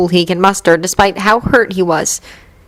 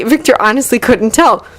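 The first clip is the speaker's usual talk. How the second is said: usual speaking voice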